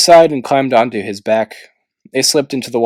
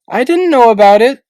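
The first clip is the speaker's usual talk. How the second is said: high, louder indignant protest; objecting; 13 semitones higher, 7 dB louder than usual. A narrator's reading. Sunny objecting to Tsunami, high indignant protest. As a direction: protest your innocence, high. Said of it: "Sunny objected".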